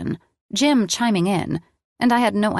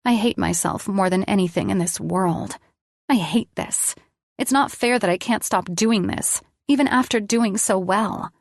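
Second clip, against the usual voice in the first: ordinary delivery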